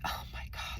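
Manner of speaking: breathy, faked gasping whisper; mock whisper. Teacher faking shock in a hushed whisper. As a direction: whisper it like a guilty fake victim.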